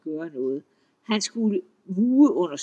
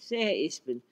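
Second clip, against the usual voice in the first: plain reading voice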